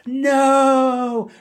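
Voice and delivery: host acting out the steamrolled guard's scream; mock no shout. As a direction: yell no like a panicked guard. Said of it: "he's just like".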